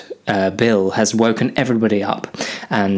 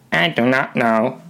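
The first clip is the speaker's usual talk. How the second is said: robot voice; 3 semitones higher than usual. Acted. Android Bill speaks robotically.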